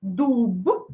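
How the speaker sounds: дуб drawn out with a fully voiced b; voiced final consonant. Shown as a demonstration. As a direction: end дуб on a released "b", not p. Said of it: "дзвінко".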